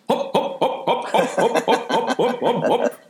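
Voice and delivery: high breathy hop hop of marching cops; playful mock police march noise; imitated marching noise. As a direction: chant hop hop like a squad in step. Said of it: "you can hear them by this noise".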